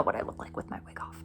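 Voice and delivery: breathy confiding whisper. Retold or imitated. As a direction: let them in on a secret, whispered. [name]'s confiding quote, whispered.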